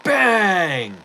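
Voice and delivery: sudden loud high bark of bang; mock yell. Imitating the yoga instructor's shout. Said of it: "he has you stand up and go".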